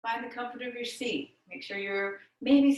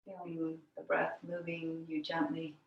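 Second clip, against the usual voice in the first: guided meditation; 5 semitones lower, 6 dB quieter than usual. Real, not acted. Yoga teacher guiding students into the breath, meditative. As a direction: lower and quieter, easing them gently into stillness.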